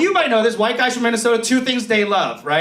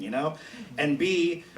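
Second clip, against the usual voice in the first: ordinary delivery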